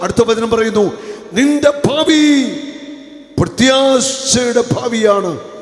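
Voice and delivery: loud, high, slow proclamation of hope; fervent preaching. Acted. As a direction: declare hope to the crowd. Preaching at full force. (ആവേശത്തോടെയുള്ള പ്രസംഗം)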